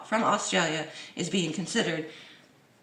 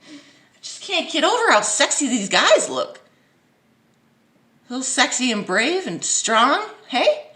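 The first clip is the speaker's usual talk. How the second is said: higher, louder gushing voice; mock swooning; 5 semitones higher, 6 dB louder than usual. Vlogger mocking a commenter, higher gushing voice. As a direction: gush over them.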